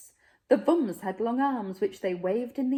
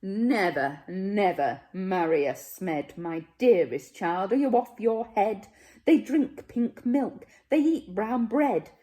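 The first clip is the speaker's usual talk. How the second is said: outraged refusal; 2 semitones higher, 6 dB louder than usual. Acted. Grandparent shocked that the child would wed a Smed, loud outrage. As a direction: cry out in horror at the match.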